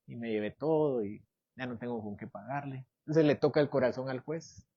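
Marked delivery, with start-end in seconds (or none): 0.1-2.9 soft, hushed begging of a sorry thief; a hint of tearful pleading; lightly acted tearful thief; mock tearful plea. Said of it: "Imagínense que se pone a llorar".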